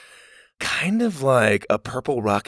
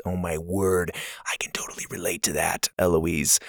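whispering; 6 semitones lower, 6 dB quieter than usual. Real, not acted. Host reacts to review.

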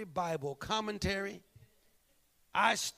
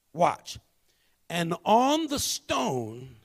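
scripture reading; 2 semitones lower, 5 dB louder than usual. Acted. Reading scripture aloud in sermon.

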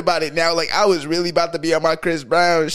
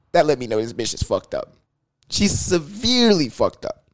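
usual speaking voice

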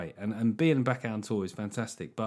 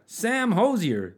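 put-on American accent; 8 semitones higher, much slower than usual. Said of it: "sounds like an American name".

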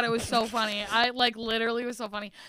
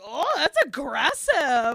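mock taken aback; 4 semitones higher, 5 dB louder, slower than usual. Retells reply to nun.

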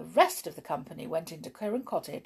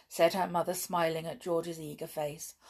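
ordinary delivery

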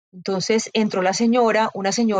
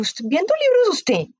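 high, drawn-out mocking disbelief; ironic mockery; 9 semitones higher, slower than usual. Man belittles the woman browsing books, mockingly ironic. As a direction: imitate a scornful man, stretching the question high. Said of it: "en un tono irónico, burlón".

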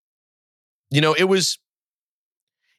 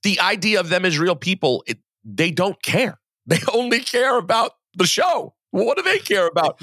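high-pitched, exasperated rant; worked-up insistence; 7 semitones higher than usual. Real, not acted. Host explaining kids only care about the show, worked-up. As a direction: argue it with pitch riding high.

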